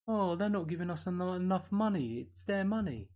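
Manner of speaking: sarcastic high-pitched mock complaint; mocking whine. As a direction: mimic a spoilt complainer begging for handouts. Mocking clubs complaining to government.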